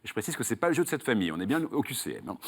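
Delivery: usual speaking voice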